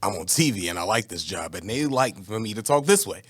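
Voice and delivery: low, soft, measured professional explanation; code-switched work voice. Acted. Co-host playing interviewer explaining himself to Primetime, careful work voice.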